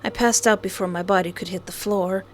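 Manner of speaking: normal talking voice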